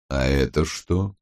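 low, slow, even question; calm, unalarmed. A narrator's reading. Criminologist interrupts the arguers, low and calm. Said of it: "Тон его не был ни встревоженным".

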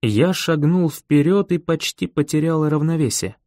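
plain reading voice

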